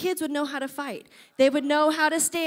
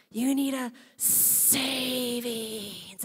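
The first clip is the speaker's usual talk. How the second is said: slow creature drawl; 6 dB quieter, much slower than usual. Retold or imitated. Creature's slow spoken line.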